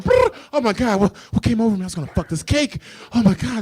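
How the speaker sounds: shrill panicked outburst. Acted. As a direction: freak out in a high voice. Acted panicked quote, high pitch.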